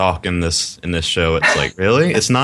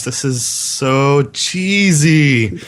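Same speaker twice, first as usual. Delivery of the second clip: slow, drawn-out disbelief; mock groan of disbelief; exasperated; 5 semitones higher, much slower than usual. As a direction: stretch out the cheesy. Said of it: "I was like".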